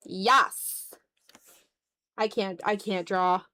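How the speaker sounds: ordinary talk, nothing marked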